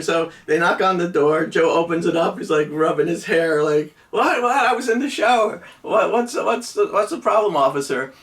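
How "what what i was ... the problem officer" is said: higher, wide-eyed "what, officer?"; feigned innocence. Retold or imitated. Joe to the cop at the door, playing innocent and higher. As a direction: play dumb like you just left the shower.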